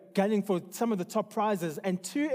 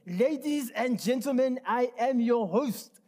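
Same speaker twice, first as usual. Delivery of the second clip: put-on German accent; 5 semitones higher than usual. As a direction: ham up a German emcee accent. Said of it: "I'm gonna try my best German accent".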